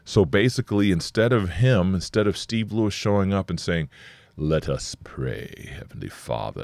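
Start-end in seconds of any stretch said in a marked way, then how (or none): 4.4-6.6 mock prayer voice. Mocking a long formal prayer.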